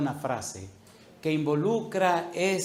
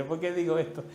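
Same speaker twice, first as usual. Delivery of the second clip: normal talking voice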